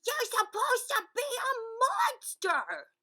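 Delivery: high loud scolding shout. A narrator's reading. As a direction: yell at him in dismay. Group scolding the monster.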